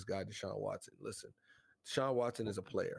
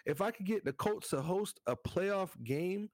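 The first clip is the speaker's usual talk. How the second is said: excited; 6 semitones higher than usual. Real, not acted. Excited Christmas wish about Colts.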